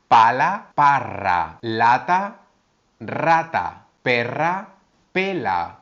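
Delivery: teacher minimal pairs l and r; l versus r contrast. Shown as a demonstration. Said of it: "La R es más vibrante".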